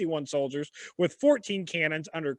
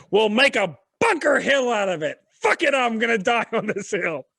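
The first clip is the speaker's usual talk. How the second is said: defiant quote; 5 semitones higher, 4 dB louder than usual. Retold or imitated. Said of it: "and I quote".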